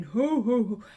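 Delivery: loud jolly ho ho ho; hearty mock Santa laugh. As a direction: boom it out like Father Christmas. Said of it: "And then he'd come in".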